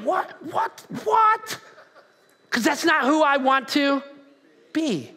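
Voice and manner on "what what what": high-pitched, disbelieving repeats; acted bewilderment. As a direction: react in mock shock, pitch climbing. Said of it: "you go back and you're like".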